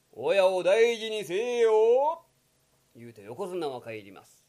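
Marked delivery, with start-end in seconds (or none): 0.1-2.2 very slow, weighty admonition; slow stern warning. Acted. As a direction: lay it down slowly. Yokozuna parting warning. (ゆっくり重々しく諭す)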